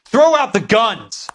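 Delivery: slow, loud barked order; commanding shout. Acted. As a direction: shout it slowly, leave no room to argue. Armed standoff, ordering surrender.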